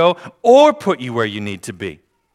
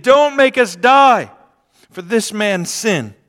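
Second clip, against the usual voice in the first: slow, much higher, loud plea; pleading; 9 semitones higher, 7 dB louder, slower than usual. Acted. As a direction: beg for your lives, slowly. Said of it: "they pleaded".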